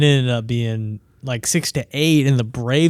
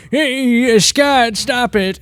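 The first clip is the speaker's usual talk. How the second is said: put-on old grandfather; 10 semitones higher, 3 dB louder, much slower than usual. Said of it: "Pete's grandfather's like".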